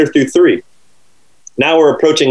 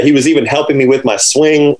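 normal talking voice

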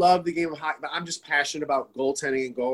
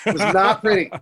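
higher, louder, through a laugh; 5 semitones higher, 5 dB louder than usual. Real, not acted. Laughing at his own defeat.

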